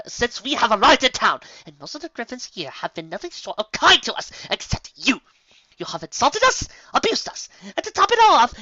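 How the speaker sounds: loud, high rising tirade; furious tirade. A narrator's reading. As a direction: let it build to a roar. Rarity's tirade at the commander.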